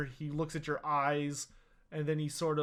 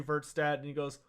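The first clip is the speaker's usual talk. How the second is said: ordinary delivery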